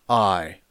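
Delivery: "I" set apart and drawn out; stress on I. Shown as a demonstration. Preacher showing where Paul puts the emphasis, stressed "I". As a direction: land squarely on "I". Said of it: "the emphasis is on".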